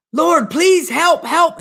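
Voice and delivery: high, drawn-out begging for help; desperate plea. Acted. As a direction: plead upward as the dangling hiker. Hiker begs the Lord for rescue.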